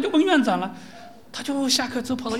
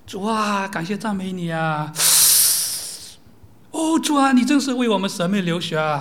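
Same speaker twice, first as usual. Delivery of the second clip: mocking prayer tone; 4 dB louder than usual. Preacher mimicking the students' affected prayer tone, hammed-up. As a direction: parody a sing-song seminary prayer. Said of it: "他怎么腔调呢？".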